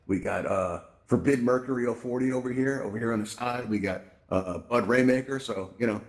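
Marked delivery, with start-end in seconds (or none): none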